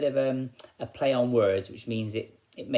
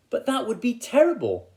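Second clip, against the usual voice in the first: weary woodcutter; 12 semitones higher, 4 dB louder than usual. Acted. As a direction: moan it like a worn-out woodcutter who worked all day. Woodcutter protests, voiced in story.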